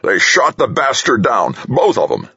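high, jubilant exclamation; crowing. A narrator's reading. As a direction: crow it in triumph. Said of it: "General Higgins crowed".

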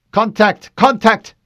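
urgent shouted warning; shouting alarm. Acted. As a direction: sound the alarm, loud and high. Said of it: "Lieutenant Dominique shouted".

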